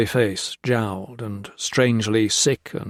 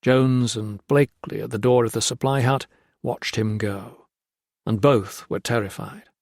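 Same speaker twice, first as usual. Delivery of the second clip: usual speaking voice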